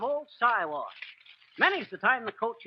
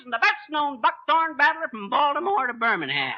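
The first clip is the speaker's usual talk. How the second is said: boastful alliterative patter; 3 semitones higher than usual. Acted. Braggart's alliterative title patter.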